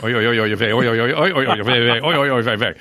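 loud jumbled oi and vey; irregular oi vey chant. Retold or imitated. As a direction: chant oi oi unevenly like fibrillation. Mimicking fibrillation, joke.

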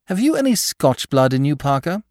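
high, mildly bitter teasing; a touch of bitterness, voice up high; bitter jab. Acted. Lord Peter needling Parker, mildly bitter and high. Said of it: "inquired his colleague bitterly".

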